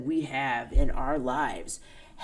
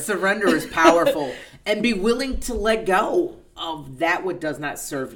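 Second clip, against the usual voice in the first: laughing; 4 semitones higher, 7 dB louder than usual. Real, not acted. Laughs while saying.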